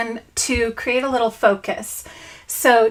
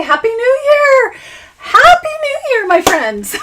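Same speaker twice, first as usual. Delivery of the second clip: much higher and louder, bursting with cheer; joyful high happy new year; excited greeting; 11 semitones higher, 6 dB louder than usual. Real, not acted. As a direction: shout it out like a party toast.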